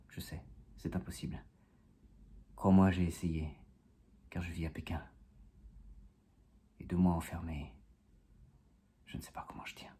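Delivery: low, very soft, halting recitation; poem lines fading away with long pauses; hushed confession. Acted. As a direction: confide it almost in a whisper. Confides confinement struggle in poem.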